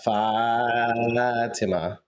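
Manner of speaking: Fatima drawn out very slowly, FAT-ih-ma; slow careful Fatima. Shown as a demonstration. Offering co-host a new Fatima, slow and careful. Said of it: "Is that better?".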